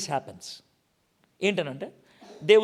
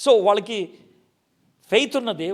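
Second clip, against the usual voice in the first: ordinary delivery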